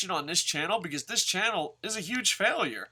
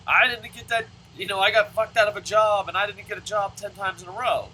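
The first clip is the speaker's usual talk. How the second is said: mock cheer; 5 semitones higher, 5 dB louder than usual. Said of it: "you don't go up and be like".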